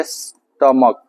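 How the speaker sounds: स्टमक drawn out slowly, ending in a K sound; low, very slow स्टमक with hard final K; teaching pronunciation. Shown as a demonstration. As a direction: break स्टमक into slow parts for learners. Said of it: "इसको स्टमक पढ़ते हैं".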